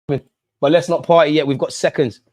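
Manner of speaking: ordinary delivery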